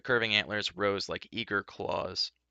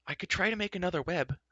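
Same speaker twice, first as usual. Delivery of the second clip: higher, light suggestion; suggesting; 9 semitones higher than usual. A narrator's reading. Elenwy suggesting to companions, higher voice. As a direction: offer it tentatively. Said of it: "Elenwy suggested".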